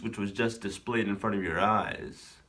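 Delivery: very low solemn announcer voice; low mock epic outro; deep mock narrator. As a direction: announce it like a trailer.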